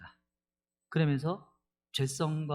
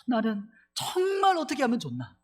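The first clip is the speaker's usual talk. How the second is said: higher, pleading voice of a man at his wits' end; despairing Paul; 8 semitones higher than usual. Retold or imitated. Pastor voicing Paul's helpless plea in a higher voice. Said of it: "이렇게 표현하는 거예요".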